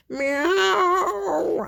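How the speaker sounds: long, high cat wail; demanding hungry meow. A narrator's reading. Kitty demanding to be fed now, wailing meow. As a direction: yowl for food, high and drawn out. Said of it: "I'm pretty darn hungry".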